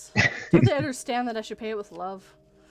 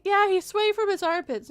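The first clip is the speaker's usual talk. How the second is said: higher, lightly voiced child; kid voice; 8 semitones higher than usual. Kids listing dad's flaws, lightly voiced child. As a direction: tattle like a little kid. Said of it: "he's like".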